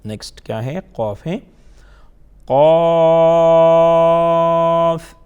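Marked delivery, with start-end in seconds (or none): 2.4-5.2 قاف الف stretched long and loud; drawn out spelling. Shown as a demonstration. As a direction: chant it slowly and loudly for the children. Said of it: "drawn out spelling". (قاف الف کو کھینچ کر بلند آواز میں)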